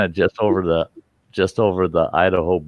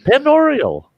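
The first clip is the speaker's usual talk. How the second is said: high, louder mock visitor voice; non-local; 5 dB louder than usual. Mock non-local repeating the lake name, high put-on voice. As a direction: imitate an out-of-towner insisting, pitched way up. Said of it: "you always knew the non-locals".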